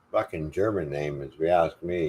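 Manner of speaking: usual speaking voice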